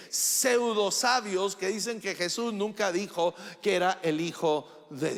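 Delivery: loud, high, driving pulpit voice; full-force preaching. Acted. A preacher thunders at his congregation, loud and high. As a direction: preach it hard to the whole church.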